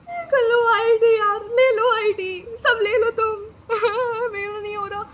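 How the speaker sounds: loud, high, sobbing plea; crying in front of guard. Retold or imitated. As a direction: wail it out as a desperate give-up. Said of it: "guard के सामने रो रही हूँ".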